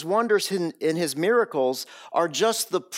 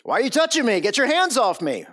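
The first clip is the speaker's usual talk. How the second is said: startled protest; 7 semitones higher, 4 dB louder, faster than usual. Pretend. Deaf man reacting to stranger.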